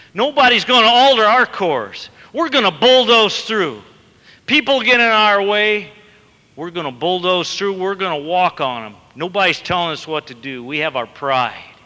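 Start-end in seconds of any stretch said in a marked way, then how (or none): 0.1-8.8 defiant boast. Acted. Preacher mimics proud self-will.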